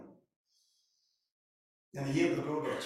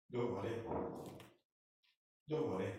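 calm reassurance; 6 semitones lower, 7 dB quieter than usual. Acted. Moses calms the frightened people.

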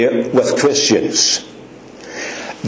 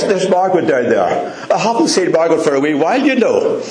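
ordinary talk

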